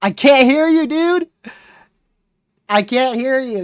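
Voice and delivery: high, loud call. Real, not acted. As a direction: shout over bad reception. Calling over bad connection.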